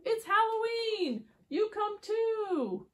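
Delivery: very high, drawn-out invitation; excited little truck. Acted. Little Blue invites everyone to Halloween, high excited call. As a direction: sing it out as an eager little truck. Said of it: "says Little Blue".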